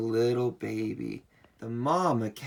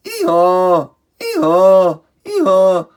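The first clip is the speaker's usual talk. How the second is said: very loud, high ee-haw; donkey bray; 6 semitones higher, 13 dB louder than usual. Pretend. Answering what the donkey says, loud high braying. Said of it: "What does the donkey say?".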